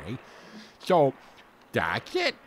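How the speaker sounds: ordinary talk, nothing marked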